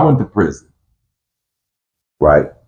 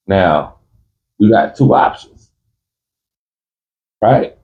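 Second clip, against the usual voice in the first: usual speaking voice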